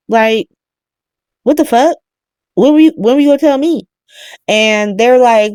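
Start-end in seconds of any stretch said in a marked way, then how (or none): 1.4-3.9 high, fast, indignant demand; mock outraged. Carly snapping at Sonny and Jason, high and fast. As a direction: blurt it out, betrayed and fuming. Carly angry at being left out.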